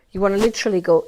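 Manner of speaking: usual speaking voice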